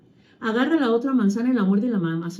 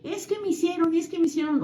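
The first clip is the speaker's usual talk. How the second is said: high, whiny "es que me hicieron"; teacher mimicking a pupil's whiny complaint; whiny child complaint; 8 semitones higher, 3 dB quieter than usual. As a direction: mimic a kid tattling. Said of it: "es de".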